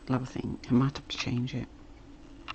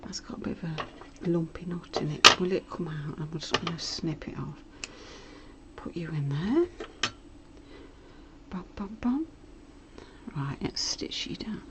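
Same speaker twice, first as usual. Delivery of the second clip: whispering; 6 dB quieter than usual. Real, not acted. Whispers while sewing.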